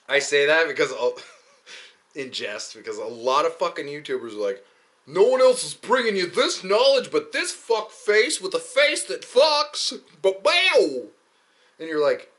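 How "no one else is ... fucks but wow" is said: loud, high, hammed-up boast; mock a self-promoting YouTuber at full volume; hyped YouTuber. YouTubers bragging about their knowledge, hammed-up hype.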